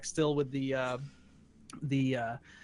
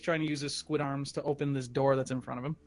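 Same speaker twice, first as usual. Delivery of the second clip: ordinary delivery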